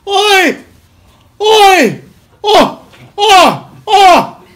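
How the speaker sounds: big high joyful shouts of Oi; joyful shouting. Retold or imitated. Big man calling across the street, high joyful shouts. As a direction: bellow Oi across the street with delight. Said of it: "I'm now that big guy".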